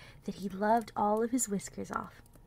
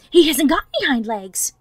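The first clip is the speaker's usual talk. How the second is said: loud, higher jeering call; 5 semitones higher, 11 dB louder than usual. A narrator's reading. Wild rabbit mocks the toy rabbit, loud and high. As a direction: call it out to the whole field. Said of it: "he called out".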